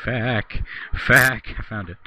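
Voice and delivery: ordinary talk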